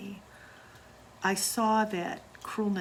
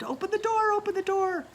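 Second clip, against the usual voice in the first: much higher, louder terrified screaming; locked in the basement, the boy screams in terror; retold scream; 14 semitones higher, 6 dB louder than usual. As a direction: scream it like a trapped child begging to be let out. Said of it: "he screamed".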